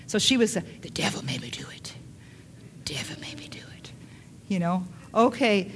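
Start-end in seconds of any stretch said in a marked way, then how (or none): 0.5-3.9 hushed mock whisper, barely any voice; whispered excuse. Eve blaming the serpent for her sin, whispered.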